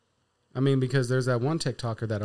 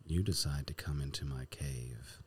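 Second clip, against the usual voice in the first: lightly voiced menacing villain; low, taunting villain lair voice; 7 semitones lower than usual. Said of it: "I could actually sound off like a villain".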